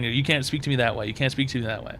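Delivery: ordinary talk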